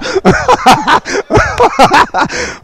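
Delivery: long, high cackling laugh; hearty laugh. Real, not acted. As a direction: crack up at your own joke. Laughs at own joke.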